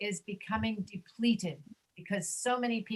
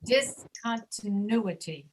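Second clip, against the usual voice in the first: slow "Discontinuity" with the accent on the U sound; measured "Discontinuity", dis-con-ti-NU-i-ty; stress on NU; slower than usual. Shown as a demonstration. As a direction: lean on NU in "Discontinuity", syllables spaced out. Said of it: "the U sound that's accented".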